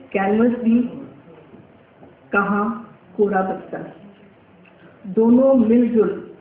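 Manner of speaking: measured recital with long pauses between phrases; poem recitation. Acted. Painter reciting her short poem to the audience, measured poetic recital. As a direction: recite it line by line, lifting each opening phrase.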